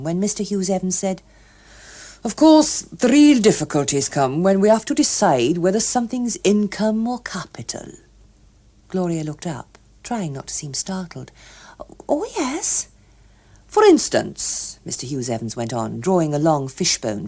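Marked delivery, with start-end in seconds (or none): 2.2-7.9 louder, earnest tax-man voice; lightly voiced pompous official; lecturing official. Hughes Evans lecturing. 12.1-12.9 put-on higher, eager girlish voice; a young woman trying to sound poised; lightly voiced eager Gloria. 13.7-14.8 louder, earnest tax-man voice; lightly voiced pompous official; lecturing official. Hughes Evans voiced.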